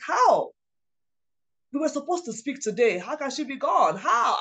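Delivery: anguished disbelief. Retold or imitated. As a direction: cry out the questions in retold shock. Retelling learning of mother's death.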